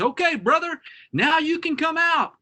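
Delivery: high-pitched distant holler; calling out. Acted. Field mouse to his hidden brother at the row's end, calling out. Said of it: "calls off to the other end of the row".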